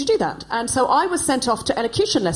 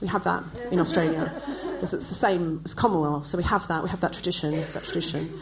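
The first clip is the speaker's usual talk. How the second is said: softer, dry Australian 'we have that', laughing; weary Australian reply; 4 semitones lower, 5 dB quieter than usual. Retold or imitated. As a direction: shrug it off in quiet Aussie deadpan. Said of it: "and you go".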